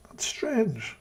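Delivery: high, hushed insinuating whisper; sarcastic whisper. Real, not acted. Streamer hinting to viewers about Loveday, in a sarcastic whisper. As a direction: breathe it like a pointed secret.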